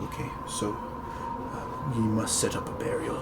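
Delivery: soft, whispered directions; hushed instruction. Acted. Priest telling the party to prepare the burial, whispering. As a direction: keep it under your breath.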